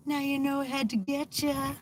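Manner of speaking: high, sing-song taunting spirit; teasing jinn voice. Pretend. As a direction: tease like a mischievous spirit. Voicing a spirit taking over.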